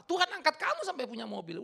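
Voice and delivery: usual speaking voice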